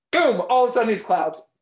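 booming announcement of the change; loud boom. A narrator's reading. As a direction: burst it out. Magic transformation.

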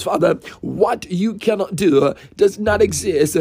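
ordinary talk

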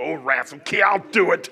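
mock reluctant surrender, laughing; grudging giving in. Man put under conviction by the Holy Spirit, comic grudging consent. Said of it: "he starts putting you under conviction".

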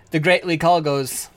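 normal talking voice

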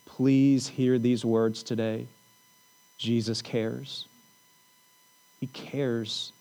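low, soft, comforting preacher voice; gentle pastoral reassurance. Real, not acted. Pastor comforts the fearful congregation.

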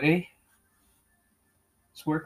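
ordinary talk, nothing marked

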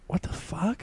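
breathy unvoiced whisper of disbelief; hushed what-the-fuck; whispered aside. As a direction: mutter it under your breath, stunned. Whispered aside in retold story.

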